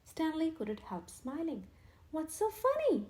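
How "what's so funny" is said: much higher, louder bewildered ask; bewildered question. A narrator's reading. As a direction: ask in confusion. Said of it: "he asked".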